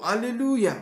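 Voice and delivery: high, loud hallelujah; joyful cry. Real, not acted. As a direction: cheer it out with joy.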